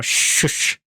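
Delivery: ordinary talk, nothing marked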